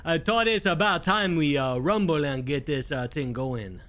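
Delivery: drawling bayou Cajun voice; lilting Cajun, lazy swamp talk; Cajun swamp accent. Acted. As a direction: lay on a Louisiana Cajun accent. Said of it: "like a swamp accent".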